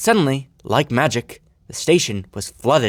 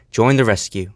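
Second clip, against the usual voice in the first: usual speaking voice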